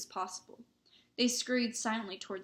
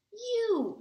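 high, loud outburst of fury; furious; 13 semitones higher, 6 dB louder than usual. A narrator's reading. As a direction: spit out an angry accusation. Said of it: "said Ron furiously".

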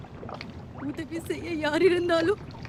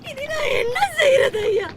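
sobbing despair; 4 semitones higher than usual. Acted. Widow despairs.

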